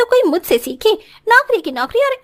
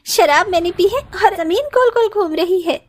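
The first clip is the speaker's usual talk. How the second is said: normal talking voice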